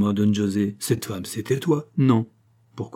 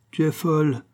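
ordinary delivery

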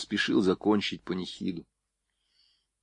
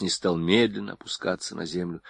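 ordinary delivery, nothing marked

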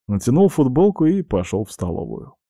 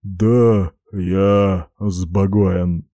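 put-on drunk slur; 3 dB louder, much slower than usual. Drunk samurai slurring.

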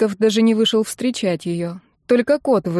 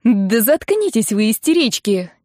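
mildly raised, exasperated rebuke; losing patience; 2 semitones higher than usual. A narrator's reading. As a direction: snap at wailing siblings. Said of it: "не выдержала Нина и топнула". (не выдержав, раздражённо)

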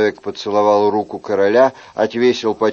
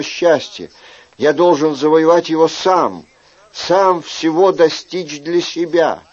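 put-on higher-pitched, earnest young suitor; ardent young courtier; 5 semitones higher than usual. Young man tells the king of hopeless love, ardent. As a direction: plead your case to the king in a raised voice.

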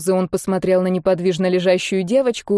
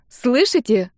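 put-on higher, insistent girlish call; girl's cry of protest; 4 semitones higher, slower than usual. A narrator's reading. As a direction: demand to be heard, lightly raised. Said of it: "выкрикнула девочка". (требовательный возглас девочки)

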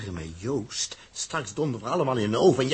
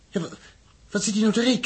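much higher, startled; alarmed question; 11 semitones higher, 4 dB louder, much faster than usual. Acted. Dirk asking what Huub is calculating, startled high question. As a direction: squeak it out in alarm.

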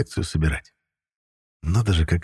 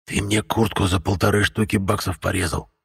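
a hint of a hissing ghoul, softer; hissed; 3 dB quieter than usual. A narrator's reading. Ghoul hissing at the hero about his slashed jacket, lightly voiced. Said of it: "прошипел вурдалак".

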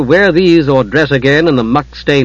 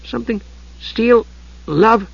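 high kender voice, picking through words; reading note aloud; 8 semitones higher than usual. A narrator's reading. Tas reading Palin's note to Usha, haltingly. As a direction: read haltingly as if deciphering a scrawl.

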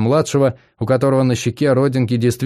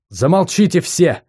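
ordinary delivery

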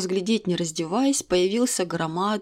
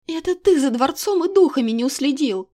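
higher, louder accusation; angry blame; 6 semitones higher, 4 dB louder than usual. Acted. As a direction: fling the fault at him. Heated quarrel, heroine.

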